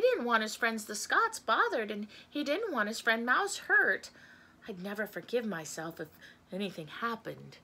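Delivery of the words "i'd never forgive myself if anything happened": soft, lower musing; hushed, inward worry; worried private thought. A narrator's reading. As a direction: think it quietly aloud. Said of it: "he thought".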